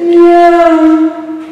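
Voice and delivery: long, high-pitched meow; cat meow. Shown as a demonstration. Teacher quizzing the child on the animal, long high meow. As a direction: hold a high cat's cry.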